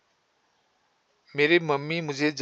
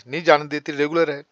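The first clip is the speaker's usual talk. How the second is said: normal talking voice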